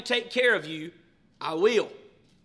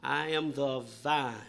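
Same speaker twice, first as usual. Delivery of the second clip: softly recited; 7 semitones lower, 7 dB quieter, slower than usual. Shown as a demonstration. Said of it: "Anybody want to say it out loud".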